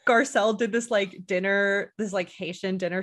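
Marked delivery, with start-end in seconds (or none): none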